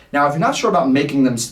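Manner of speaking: usual speaking voice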